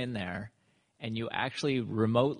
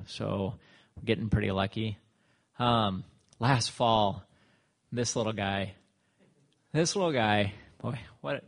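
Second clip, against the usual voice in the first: ordinary talk, nothing marked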